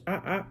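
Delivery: high monkey "ah ah"; monkey noises. Acted. Parent voicing George the monkey, monkey noises. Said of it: "and he goes".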